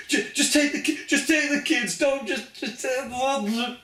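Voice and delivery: high, stammering emotional plea; Kenny emotional. Retold or imitated. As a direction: imitate a choked-up man begging, pitch pushed up.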